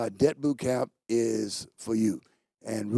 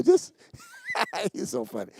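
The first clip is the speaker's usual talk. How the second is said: laughing. Real, not acted. Laughing at Josh waving.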